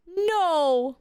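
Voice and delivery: high, drawn-out refusal; put-on high voice, stretched out; mock picky girlfriend. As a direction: imitate a girlfriend vetoing the idea. Mimicking girlfriend rejecting food suggestions.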